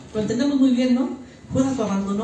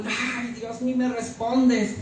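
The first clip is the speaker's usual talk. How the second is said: soft, slow mock complaint; queja a Dios; 2 semitones higher, 5 dB quieter, slower than usual. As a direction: sigh it out as a drawn-out grumble to God. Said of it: "sale un". (queja quejumbrosa)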